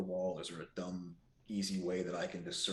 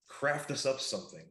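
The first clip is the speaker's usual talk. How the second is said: high, raised in-character call; louder, higher order to a companion; yelling out; 7 semitones higher, 5 dB louder than usual. Acted. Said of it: "I yell out there".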